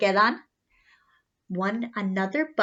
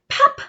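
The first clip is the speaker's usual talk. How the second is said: high, loud popping sound; high loud pop; 8 dB louder than usual. Acted. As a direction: pop it out like a bursting button. Button pops off.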